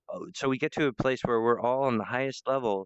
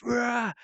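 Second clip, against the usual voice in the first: high-pitched mock roar; passionate roar; 10 semitones higher than usual. Acting Stan's big youthful performance, a passionate roar. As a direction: let out a short rah of passion. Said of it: "performs this".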